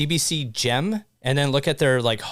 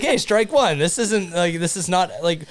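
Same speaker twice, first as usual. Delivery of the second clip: mock outraged; 6 semitones higher, 3 dB louder than usual. Mock outrage at spotting a problem.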